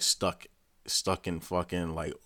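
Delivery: ordinary talk, nothing marked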